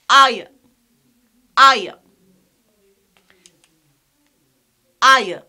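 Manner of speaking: "I" punched loud as "eye-uh"; triphthong pronunciation. Shown as a demonstration. Teacher repeating the second one, "I" as "eye-uh". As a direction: glide "I" into a short schwa. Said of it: "We pronounce it as".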